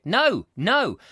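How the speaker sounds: two loud, swooping noes; mock-emphatic no; emphatic refusal. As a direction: refuse firmly, pitch leaping and dropping on each. Retelling his firm refusal.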